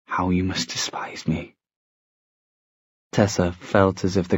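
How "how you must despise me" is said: hushed, near-whispered self-reproach; shuddering breath. A narrator's reading. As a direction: let it out softly on a breath. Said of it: "He took a shuddering breath".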